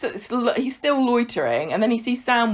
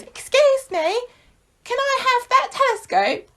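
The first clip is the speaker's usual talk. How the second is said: put-on much higher, timid child voice; little boy voice; slower than usual. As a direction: play an eager small kid asking politely. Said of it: "Sam goes".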